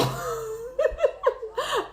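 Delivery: high, soft giggling laugh; helpless high-pitched laughter. Real, not acted. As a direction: burst into a long high giggle. Laughing at the mispronounced words.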